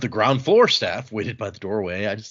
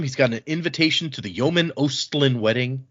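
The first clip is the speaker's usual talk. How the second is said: usual speaking voice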